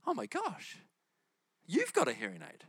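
high-pitched astonished gasp of words; retold sudden realisation. She suddenly notices the cashier's hearing aid, astonished. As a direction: spot it suddenly, voice leaping up. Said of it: "all of a sudden she notices".

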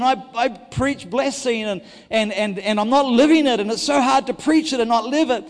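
full-force testimony sermon; impassioned preaching. Acted. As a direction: pour out the struggle from the pulpit.

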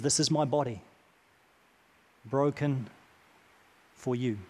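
preacher softly voicing Jesus to the congregation; hushed tender quote. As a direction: let each phrase land quietly. Jesus' words applied to listeners.

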